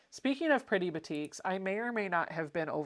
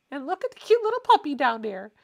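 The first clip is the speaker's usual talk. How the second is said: cooing; 8 semitones higher, 9 dB louder, faster than usual. Real, not acted. Cooing over a puppy photo.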